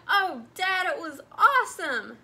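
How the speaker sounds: put-on high loud excited child; excited cry. A narrator's reading. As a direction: burst out happily to dad. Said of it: "Nemo cried".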